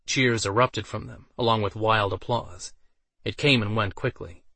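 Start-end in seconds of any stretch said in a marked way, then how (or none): none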